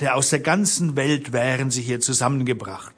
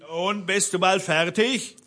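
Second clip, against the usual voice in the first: raised-pitch call from downstairs; Rufen; 7 semitones higher than usual. A narrator's reading. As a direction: call up impatiently, no real shouting. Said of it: "Da rief der Blaubart unten". (von unten heraufrufen)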